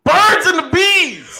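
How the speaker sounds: high, slow drawn-out scream. Pretend. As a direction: scream it out at the top. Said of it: "get my, my screaming voice on".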